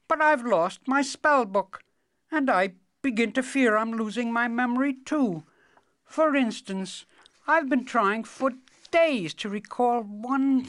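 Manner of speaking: high, forgetful wizard voice, hesitating; doddering wizard. Acted. Wizard tries to recall a spell, doddering and hesitant. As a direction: grope for a lost memory in a high voice.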